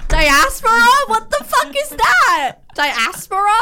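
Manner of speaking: high, loud, slowed disbelief at the word; stunned, drawn-out what the fuck; baffled outburst. Real, not acted.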